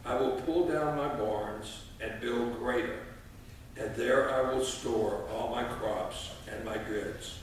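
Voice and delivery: ordinary delivery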